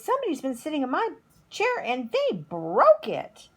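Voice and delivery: a little cub who's upset; Baby Bear. A narrator's reading. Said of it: "Baby Bear ... he says".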